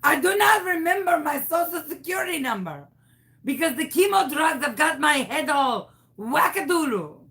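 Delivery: hammed-up French-Canadian lilt, swooping up high; fake Canadian accent. Caller blaming chemo for forgotten number, fake Canadian accent. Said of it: "What happened to your accent?".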